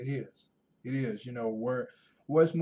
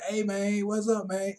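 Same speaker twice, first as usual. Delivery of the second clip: put-on Southern accent; 7 semitones higher than usual. Imitating how Southern men greet each other, with a light Southern accent. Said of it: "Or the Southern way".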